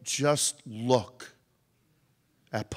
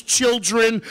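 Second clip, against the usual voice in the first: ordinary delivery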